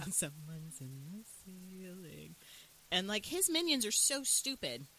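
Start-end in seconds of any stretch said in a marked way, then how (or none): 0.1-2.3 slow, soft mock taunt; creepy stalker. Imitating a vampire hearing his prey overhead, slow and creepy. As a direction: draw it out like a lurking villain.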